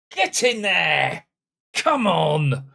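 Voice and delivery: slow, loud shout of triumph; real triumphant shout; victory shout. Real, not acted. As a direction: roar it out after the winner. Winning penalty scored.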